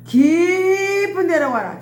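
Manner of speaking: high, slow, loud command. Retold or imitated. Preacher voicing Jesus' slow loud command. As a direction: lay out the order slowly.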